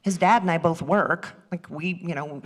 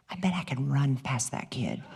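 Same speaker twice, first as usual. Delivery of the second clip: scheming aside; 4 semitones lower, 6 dB quieter than usual. Retold or imitated. Plotting to dash past boy.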